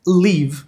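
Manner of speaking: "leave" with a long, tense ee; long-i pronunciation. Shown as a demonstration. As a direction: stretch the vowel in "leave" like Portuguese i. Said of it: "A letra E tem som de I".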